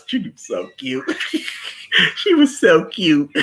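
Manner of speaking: giggling fondly as you say it; laughing. Real, not acted. Gushing, laughing about a character.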